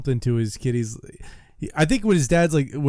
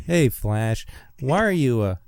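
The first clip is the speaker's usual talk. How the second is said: Meathead impression; 3 semitones lower, slower than usual. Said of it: "Mike Ranger up next".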